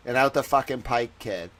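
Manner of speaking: thick Boston accent, dropping the Rs; a hammed-up Boston accent. Shown as a demonstration. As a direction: lay the Boston accent on, low and easy. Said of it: "Boston accents for every word".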